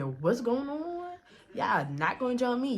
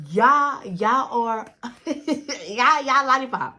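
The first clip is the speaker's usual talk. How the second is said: mock loud callout; 5 dB louder, much slower than usual. Beat Up A ones confronting the girl.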